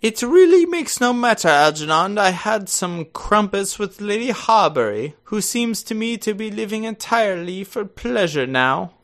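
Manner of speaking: lofty, dismissive aunt; Lady Bracknell. Acted. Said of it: "you're gonna be playing Lady Bracknell".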